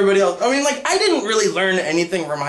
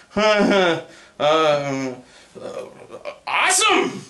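fake enthusiasm; slower than usual. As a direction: pretend to love the idea. Fake delight at amputation talk.

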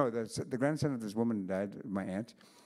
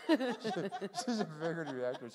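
slower, higher, said through a laugh; laughing repeat; 6 semitones higher, slower than usual. Real, not acted. Teller repeating her pun, laughing.

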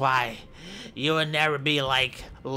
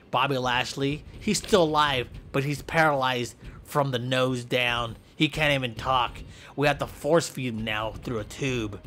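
ordinary talk, nothing marked

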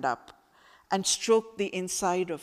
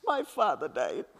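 sobbed. A narrator's reading. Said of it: "I sobbed".

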